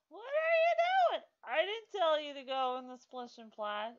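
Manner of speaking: very high, very loud scolding shriek; shocked yell at the Sim. Real, not acted. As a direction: shriek at the character for misbehaving. Yells at Sim character.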